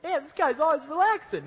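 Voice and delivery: high, loud mock exasperation; high loud joking. The preacher joking about Pablo to the congregation, high and loud. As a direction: throw it up high and loud, teasing.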